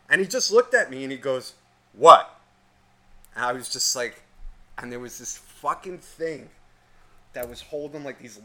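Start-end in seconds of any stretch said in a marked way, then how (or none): 1.9-2.4 loud, abrupt "What?"; mock curt challenge. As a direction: throw it out hard as a retort. Worker's curt reply to the stare.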